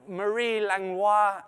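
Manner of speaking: Langlois as "lang-LWAH", French style; French pronunciation. Shown as a demonstration. Host reading out a donor's name, French pronunciation of Langlois. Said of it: "gonna go with the French".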